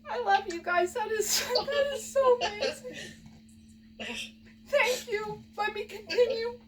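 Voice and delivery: high, tearful thank-you; crying with joy. Real, not acted. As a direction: choke up with happy tears. Moved to tears by the gift.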